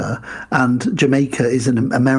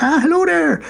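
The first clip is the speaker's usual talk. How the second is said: high, put-on Irish accent; hearty mock-Irish greeting, pitched up; Irish drunken priest; 14 semitones higher than usual. [name] greeting Beaks as a drunken priest, Irish accent. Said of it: "He's putting on an Irish accent".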